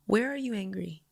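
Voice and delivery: soft, tender questioning; retold bedtime-quiet mother; gentle parent. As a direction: softly coax an upset child. Tender mother to upset child.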